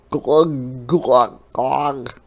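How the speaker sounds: loud gurgling glug sounds. A narrator's reading. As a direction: gurgle like you are sinking. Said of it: "gurgled Hedgehog".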